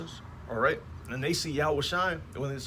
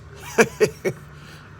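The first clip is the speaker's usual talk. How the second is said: laugh; 6 semitones higher, 4 dB louder than usual. Real, not acted. Laughs after his punchline.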